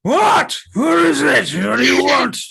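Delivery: put-on high, loud cranky old man; grumpy old man. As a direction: yell like a woken old grouch. Old man woken at door.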